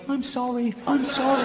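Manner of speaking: high-pitched mock apology; Louis pleading. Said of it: "Like that".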